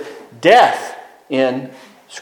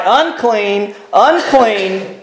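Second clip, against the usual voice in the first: a leper's lightly voiced mournful call; crying out unclean; 4 semitones higher, much slower than usual. Leper warning passersby away from him, mournful cry. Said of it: "and cry".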